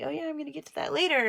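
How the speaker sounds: high, fast, airy dismissal; breezy brush-off. Retold or imitated. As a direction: toss it off as if it's no big deal. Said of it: "and I'm like".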